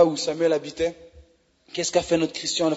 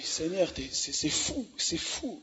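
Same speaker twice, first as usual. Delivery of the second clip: hushed awe; 11 dB quieter, slower than usual. Real, not acted. Overwhelmed, talking to God.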